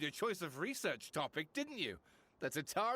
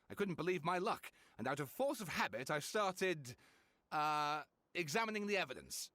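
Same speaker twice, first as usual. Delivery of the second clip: normal talking voice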